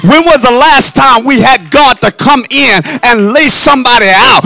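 loud, insistent shouted questions; full-force preaching. Real, not acted. Preacher challenging the church about moves of God, full-force preaching.

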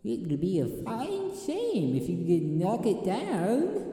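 high, slow tempting voice; sly conscience voice. Acted. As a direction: coax him like a devil on the shoulder. Imitating the cartoon conscience.

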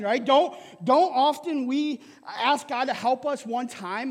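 usual speaking voice

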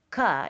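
"ka" said with an added uh vowel; wrong schwa sound. Shown as a demonstration. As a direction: sound the letter with an extra vowel. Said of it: "It doesn't say".

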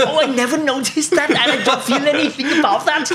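high, laughing German voice; laughing while quoting the colleague; high, amused imitation of a German speaker. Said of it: "German colleague".

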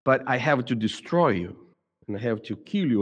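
usual speaking voice

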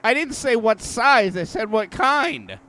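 exasperated. Retold or imitated. Mocking cashier's exasperation.

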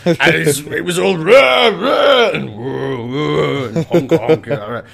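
put-on slurred drunken old colonial raconteur; Rowley Burkis. Drunk Muldoon reports on the Rex, slurred impression. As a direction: ramble tipsily, bursting into loud animal noises. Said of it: "Rowley Burkis from".

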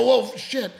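loud, high mock alarm; taken aback. Reacting to someone who just defends themselves, mock startled. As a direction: jump up in pitch, startled.

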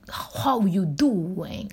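slow, drawn-out New York accent. Shown as a demonstration. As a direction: lean into a New York greeting, very slowly. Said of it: "I'm trying to get the New York accent".

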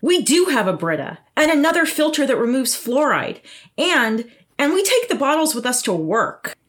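frustrated shout. Acted. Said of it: "Antonia shouts".

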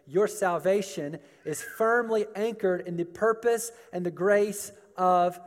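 emphatic preaching. Real, not acted. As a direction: preach it with rising conviction. Preaches at full force.

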